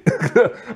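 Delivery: quick high chuckle; amused laugh. Real, not acted. Laughs at his own reaction.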